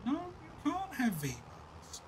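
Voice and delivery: mock-stern dad refusing V-Bucks; firm refusal. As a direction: lay down the law, slowly.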